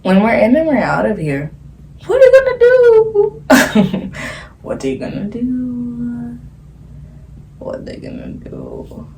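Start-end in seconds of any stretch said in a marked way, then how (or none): 2.1-4.1 slow high squealed question; silly high voice. Acted. 7.6-9.1 slow very low rumble; silly deep voice. Acted.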